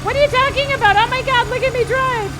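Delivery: loud yelling. Real, not acted. Yelling at game driving.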